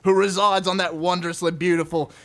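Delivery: hammed-up, sweary Aussie rules reading; put-on broad Australian accent, punching the swear words; bad Aussie accent. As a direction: read it out like a crude Australian trucker. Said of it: "do it in Aussie accent".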